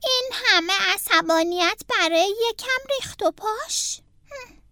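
a mild, put-upon grumble to oneself; muttering to himself. A narrator's reading. Franklin murmurs to himself after mother's scolding, mildly grumbling. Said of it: "با خودش زمزمه کرد".

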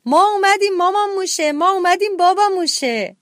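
high, loud gleeful homecoming cry; happy shout. A narrator's reading. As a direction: shout it out as you run home. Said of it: "داد زدن".

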